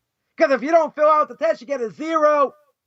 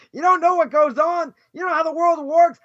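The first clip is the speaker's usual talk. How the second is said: ordinary talk, nothing marked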